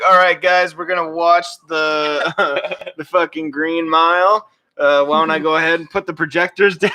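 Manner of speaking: announcing a movie night, half-laughing; mock Biden announcement. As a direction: play a clueless host calling out to the room. Mocking Biden as host.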